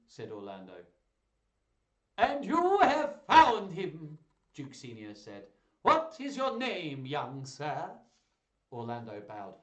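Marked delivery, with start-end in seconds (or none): 2.2-4.2 slow, very loud, high declamation; booming duke. A narrator's reading. Duke Senior voiced, booming. 5.8-7.9 slow, very loud, high declamation; booming duke. A narrator's reading. Duke Senior voiced, booming.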